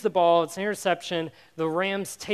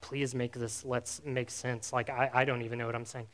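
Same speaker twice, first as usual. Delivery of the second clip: quiet inner panic; 7 semitones lower, 9 dB quieter than usual. Retold or imitated. Panicked thoughts in your head.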